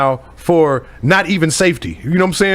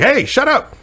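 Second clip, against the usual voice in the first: higher-pitched mock roar; pitched-up, commanding shout, half played; raised voice; 9 semitones higher, slower than usual. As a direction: bark it out like an old-school boss. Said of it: "can't even raise their voice now".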